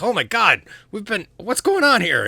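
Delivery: high, loud, alarmed exclamation; startled outcry. Acted. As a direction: blurt it out in confused panic. Acted creature panicking at purple glow.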